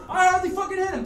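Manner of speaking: high, loud acted wail; frantic protest. Punched skinhead pleads his case to Dave, frantic protest. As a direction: act out yelling an excuse under blows.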